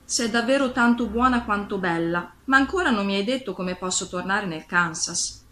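ordinary delivery

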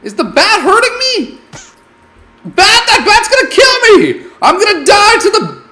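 high, loud panic half laughing; panicked laughing shout. Real, not acted. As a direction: yelp and shout as the bat bites. Bat attacking him.

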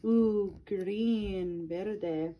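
plain reading voice

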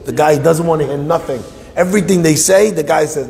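plain reading voice

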